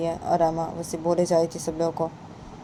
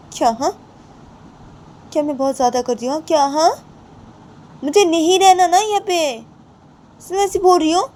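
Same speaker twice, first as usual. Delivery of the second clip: higher and louder, defensive snapping back; चिढ़कर जवाब देती बहू; indignant retort; 8 semitones higher, 9 dB louder than usual. Acted. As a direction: throw the accusation back at her. Rama retorting sharply.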